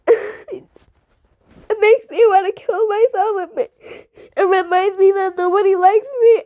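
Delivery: slow, high, tearful; words breaking under sobs; crying. Real, not acted. Kid telling viewers about hate, sobbing.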